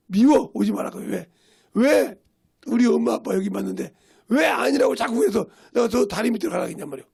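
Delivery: imitating a cross child's outburst; mock child protest. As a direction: protest loudly like a teased little kid. Upset child protests to teasing uncle.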